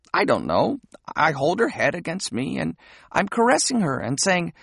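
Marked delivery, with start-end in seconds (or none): none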